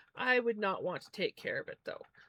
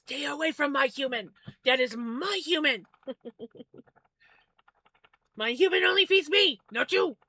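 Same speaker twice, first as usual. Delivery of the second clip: higher, louder jealous bird voice guarding its owner; jealous pet bird; 6 semitones higher, 8 dB louder than usual. Pretend. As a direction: squawk it out as the possessive pet. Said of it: "I am his hum-, right?".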